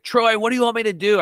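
high desperate yell at his dead brother; retold scream. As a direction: cry out to Troy in anguish. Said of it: "I just scream at the top of my lungs".

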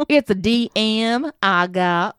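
slow, exaggerated southern drawl; hammed-up southern accent; southern accent twang. Shown as a demonstration. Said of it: "in my southern accent twang".

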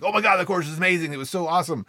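excited. Retold or imitated. Student gushing to instructor after class, excited. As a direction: gush like a thrilled student. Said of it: "this guy was all excited".

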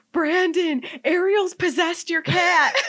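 high, loud panicked call through a laugh; frantic cry. Retold or imitated. Telling Brandon the dog possessed the cat, high and frantic.

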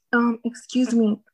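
hushed, timid request; whisper. Retold or imitated. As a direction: whisper it shyly, leaning in. Boyfriend asks shyly for kiss.

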